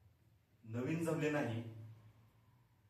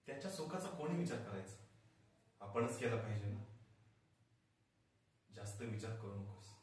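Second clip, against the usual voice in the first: quiet reassurance; 3 semitones lower, 6 dB quieter than usual. Acted. Father reassures wife quietly.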